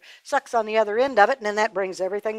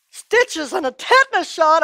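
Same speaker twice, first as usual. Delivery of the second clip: frightened outcry; 8 semitones higher, 6 dB louder than usual. A narrator's reading. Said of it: "he began to holler even louder".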